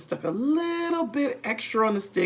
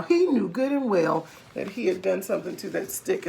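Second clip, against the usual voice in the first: usual speaking voice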